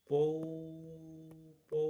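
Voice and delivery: long "पौ, पौ" blown like a conch shell; conch sound. Shown as a demonstration. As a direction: imitate the conch call "पौ". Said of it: "शंख की ध्वनि". (शंख की आवाज़ की नकल)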